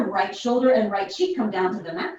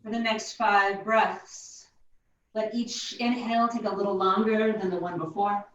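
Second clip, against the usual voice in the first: guided meditation. Acted. Guided breathing in child's pose.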